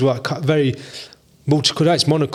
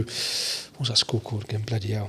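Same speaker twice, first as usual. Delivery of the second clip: much softer, lower aside; muttering to self; 3 semitones lower, 11 dB quieter than usual. Real, not acted. Man trying to recall his first school, muttering to himself. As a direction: grumble quietly while racking your memory.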